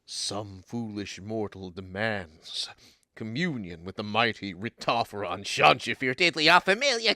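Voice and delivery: low, slow, booming god voice; mock-ominous divine pronouncement; booming deity voice. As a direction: boom like an ancient deity answering a summons. Pixie's booming summoning entrance.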